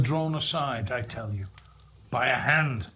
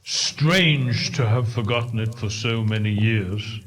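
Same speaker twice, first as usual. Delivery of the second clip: ghost observing; 5 semitones lower, 4 dB louder than usual. Acted. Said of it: "observed the ghost".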